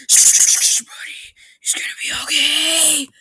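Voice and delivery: hushed whisper to a hurt dog; soothing whisper. Acted.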